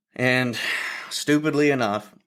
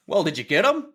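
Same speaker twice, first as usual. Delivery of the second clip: higher, rising imitation of curiosity; curious question; 7 semitones higher than usual. Doctors asking the injured hunter, imitated eager curiosity.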